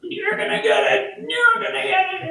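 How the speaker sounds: high, whiny mock threat; mock the dad's furious threat; lightly voiced furious father, pitched high; imitating angry dad. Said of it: "when he got really angry".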